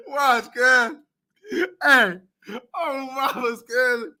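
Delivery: loud cackling through words; can barely talk for laughing; laughing hard. Real, not acted. Cracking up hard.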